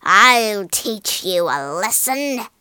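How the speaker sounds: snarling witch. A narrator's reading. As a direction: threaten like a witch, drawing out the words. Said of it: "snarled the witch".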